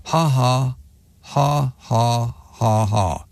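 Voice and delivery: stiff, spaced-out fake laugh, stepping down; deadpan read-out laugh. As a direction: read the written laugh word by word. Reading out a written laugh.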